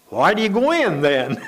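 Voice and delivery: loud, high-pitched mock exasperation; exasperated retort, played for a laugh; retold impatient outburst. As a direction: imitate someone losing patience, pitch and volume jumping. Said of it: "gotten a little impatient with him".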